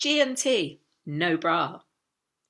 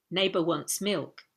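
usual speaking voice

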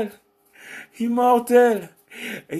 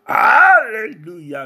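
loud, drawn-out hallelujah; exultant shout of praise; praise cry; 3 semitones higher, 8 dB louder, slower than usual. Real, not acted. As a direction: cry it out high and long in worship. Cries out in praise.

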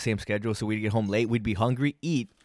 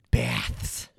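deep Batman voice; 13 semitones lower than usual. Retold or imitated. Batman correcting bats to baths.

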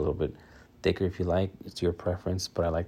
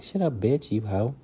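mock girl banter; 3 semitones higher than usual. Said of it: "they call each other bitch".

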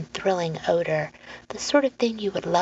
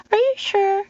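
high-pitched, hopeful little voice; wide-eyed boy; 5 dB louder than usual. Acted. Boy checking with Lenora before going in, hopeful. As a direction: ask very high, like a child.

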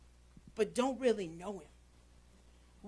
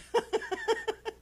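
a high burst of laughter; 10 semitones higher than usual. Real, not acted. Pastor laughing at his fence story with the church, high laugh. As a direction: laugh high at your own joke.

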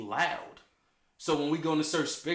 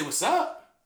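casual street slang; 6 semitones higher, 3 dB louder than usual. Shown as a demonstration. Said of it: "I say".

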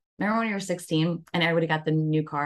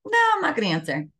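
quick, higher, brushing off a late call; dismissive refusal; 7 semitones higher, 4 dB louder, faster than usual. Retold or imitated. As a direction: rattle off a firm no. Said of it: "'cause you're like".

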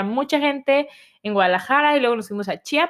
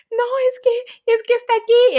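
startled mom; 13 semitones higher, 4 dB louder than usual. Retold or imitated. Mom on the phone to dad, suddenly seeing her daughter, high shocked voice. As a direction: squeal it out in shock, much higher.

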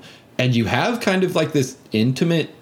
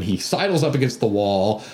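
ordinary talk, nothing marked

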